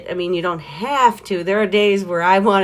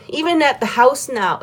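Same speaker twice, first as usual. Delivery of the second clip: ordinary delivery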